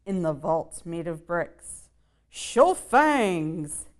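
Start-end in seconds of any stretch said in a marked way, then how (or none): 2.3-3.9 vampire papa's cry. Acted. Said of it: "Papa cried".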